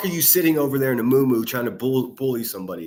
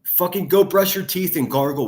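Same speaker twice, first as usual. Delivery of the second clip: angry call-out; 4 dB louder than usual. Real, not acted. Yelling back at the clip.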